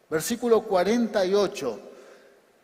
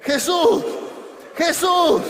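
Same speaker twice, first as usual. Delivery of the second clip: high, very loud shout of the name; full shout; 11 semitones higher, 10 dB louder, slower than usual. Shown as a demonstration. Preacher leading the congregation to shout with him, shouting. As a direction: shout it out for a crowd to join. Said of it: "Grite conmigo".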